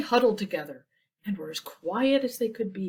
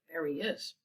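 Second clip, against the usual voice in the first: usual speaking voice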